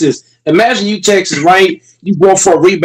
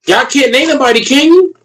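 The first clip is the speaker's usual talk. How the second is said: taunting challenge; 7 semitones higher than usual. Real, not acted. Taunting chat again.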